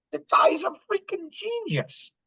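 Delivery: very high, hammed-up awe; high-pitched mock astonishment; retold amazement. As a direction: exclaim it way up high, acting amazed. Retelling his amazed reaction to Tom.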